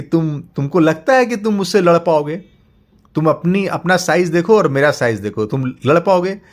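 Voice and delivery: high, loud mocking giant; scornful monster. Monster mocks father's size, scornful and loud. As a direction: laugh down at the tiny man. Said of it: "वो हंसा जोर से".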